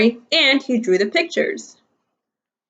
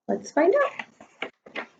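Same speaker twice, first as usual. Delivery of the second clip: soft; 8 semitones higher, 9 dB quieter, much slower than usual. Real, not acted. Teacher reading to children.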